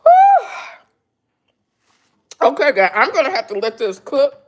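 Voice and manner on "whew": a high, loud overwhelmed whew. Real, not acted. Reacting to a thousand subscribers, high loud overwhelmed whew. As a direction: blow it out high and loud, stunned.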